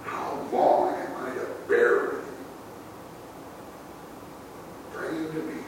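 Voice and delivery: soft, breath-heavy and tired, with long pauses; worn out and patient, not sarcastic; weary sigh. Shown as a demonstration. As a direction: let each phrase sag out on a sigh. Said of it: "He says it with a sigh".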